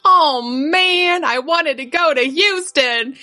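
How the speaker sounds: put-on high, whiny little girl voice; disappointed four-year-old. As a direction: pout like a four-year-old who wanted Houston. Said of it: "Mackenzie, who was four, says".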